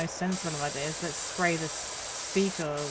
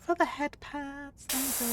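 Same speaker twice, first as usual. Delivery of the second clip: high cute thanks; 9 semitones higher than usual. Acted.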